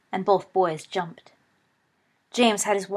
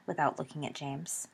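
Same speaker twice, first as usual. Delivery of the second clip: plain reading voice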